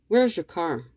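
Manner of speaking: usual speaking voice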